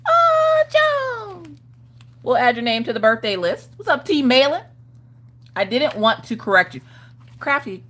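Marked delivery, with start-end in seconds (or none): 0.1-1.6 surprised gasp. Real, not acted. Gasps at a viewer's birthday.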